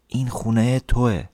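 put-on softly murmured child's secret; secretive whisper. A narrator's reading. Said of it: "جوری زمزمه کرد که انگار این یک راز". (زمزمهٔ آرام و رازگونهٔ دختربچه)

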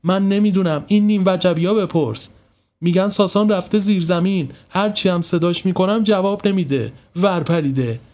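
a touch of an angry mother; mild irritation. Acted. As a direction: snap at the kids, fed up. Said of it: "حسابی کفری شده بود".

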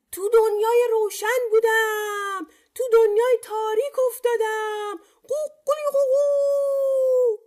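very high chant ending in a long crow; rooster crying out. A narrator's reading. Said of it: "از توی شکمش فریاد زد". (فریاد زیر و آهنگین خروس)

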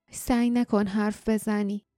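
plain reading voice

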